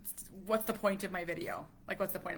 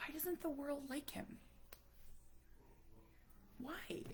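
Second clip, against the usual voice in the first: soft, higher whispered plea; anguished whisper; 8 semitones higher, 12 dB quieter than usual. Real, not acted. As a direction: whisper the question in pain. Anguished question about her son.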